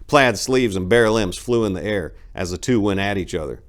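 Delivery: ordinary delivery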